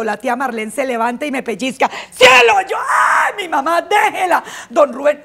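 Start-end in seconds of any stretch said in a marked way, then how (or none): 2.1-2.5 much higher, loud snap of a name; hammed-up angry aunt; sharp scolding shout. Aunt scolding while pinching. 2.7-4.4 higher, loud yelp of protest; mock child yelp; pained outcry. Girl pinched, crying out.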